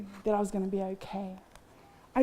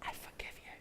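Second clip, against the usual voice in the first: soft unvoiced whisper of forgiveness; 21 dB quieter than usual. The Lord forgiving her in court, whispered. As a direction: breathe it out as a whisper. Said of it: "the Lord released and said".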